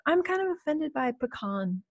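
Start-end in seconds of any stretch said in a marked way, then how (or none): 1.1-1.8 pecan said as puh-KAHN. Shown as a demonstration. Objecting to the co-host's word, pecan as puh-KAHN. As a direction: end pecan on "con", stressed.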